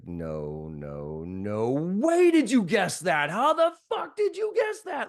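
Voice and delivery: high, loud, slowed cry of disbelief; stunned disbelief. Retold or imitated. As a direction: mind blown, he can't believe the guess. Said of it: "And the guy's like".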